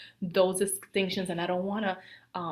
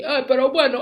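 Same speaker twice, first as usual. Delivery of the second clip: loud, higher Dominican Spanish outburst; imitating Dominican Spanish; 6 semitones higher, 11 dB louder, faster than usual. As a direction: mimic animated Dominican talk, loudly. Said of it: "they start talking like this".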